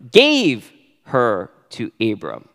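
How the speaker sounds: "gave" weighted, the phrase slowed; stress on gave. Shown as a demonstration. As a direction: lean into "gave", slow and deliberate. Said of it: "emphasis on the next verb".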